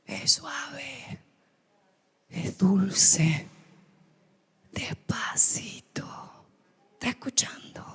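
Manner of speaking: breathy demonstration whisper of God's voice; softly whispered, like a still small voice. Shown as a demonstration. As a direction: whisper it, barely audible. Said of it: "tan suave la voz de Dios".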